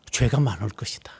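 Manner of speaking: hushed warning, breath only; whispered scriptural warning. Real, not acted. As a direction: drop to a whisper for the verdict.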